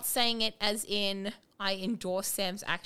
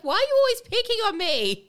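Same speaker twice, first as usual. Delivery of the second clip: much higher, louder aggrieved wail; hammed-up loud high complaint; whiny teen outburst; 12 semitones higher, 10 dB louder than usual. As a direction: overreact like a put-upon teenager. [name] overreacting to her mother.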